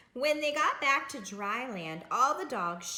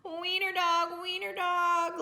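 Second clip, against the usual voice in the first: high, louder singsong teasing; laughing taunt; 8 semitones higher, 5 dB louder than usual. A narrator's reading. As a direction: chant it like a playground taunt. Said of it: "laughed the other dogs".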